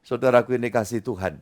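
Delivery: normal talking voice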